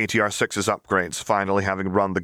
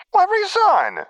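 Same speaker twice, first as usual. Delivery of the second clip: mock-shocked exclamation, much higher and louder; exaggerated gasp; 6 dB louder, slower than usual. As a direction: gasp it out like a scandalized aristocrat. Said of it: "exclaimed with an exaggerated gasp".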